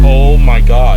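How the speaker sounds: loud, stunned outburst; shocked exclamation. Real, not acted. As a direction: blurt it out in real shock. Shocked reaction to disturbing clip.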